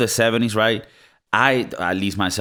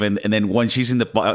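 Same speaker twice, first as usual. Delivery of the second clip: ordinary talk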